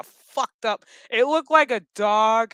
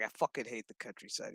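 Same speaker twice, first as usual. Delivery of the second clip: grumbling cop; 10 semitones lower, 15 dB quieter, faster than usual. Retold or imitated. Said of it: "and he's just like".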